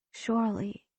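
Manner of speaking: quiet. A narrator's reading. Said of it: "surely repeated".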